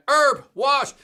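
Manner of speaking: high, loud name call; excited shoutout. Real, not acted. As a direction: call his name out big.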